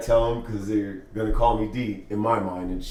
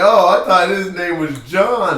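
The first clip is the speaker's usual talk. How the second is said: put-on higher, louder, slow surprised girl voice, laughing; mock girl; 7 semitones higher, 9 dB louder than usual. Imitating a girl who googles the name, slow and surprised. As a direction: play a girl caught off guard. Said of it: "be like".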